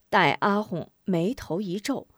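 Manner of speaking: usual speaking voice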